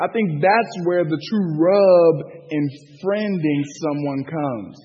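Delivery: usual speaking voice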